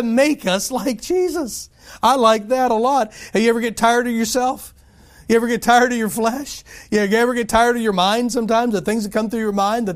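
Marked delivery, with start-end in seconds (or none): none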